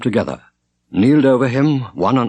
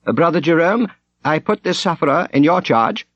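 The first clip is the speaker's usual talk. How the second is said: much higher, formal worried command; anxious prior; 7 semitones higher than usual. A narrator's reading. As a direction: prior solemnly assigning a duty, raised voice. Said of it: "frowning anxiously".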